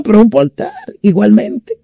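ordinary talk

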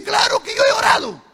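very high, fast, loud; wife yelling back, indignant; shouted reply. Retold or imitated. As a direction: fire it back at him.

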